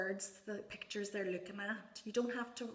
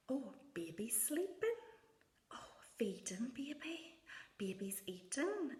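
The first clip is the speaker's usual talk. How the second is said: put-on higher, soft, hushed running commentary on baby's play; playful commentary to child; 5 semitones higher, 4 dB quieter than usual. Shown as a demonstration. Parent narrating a toddler's doll play, hushed and higher. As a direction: murmur along gently as the child plays. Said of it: "So for example".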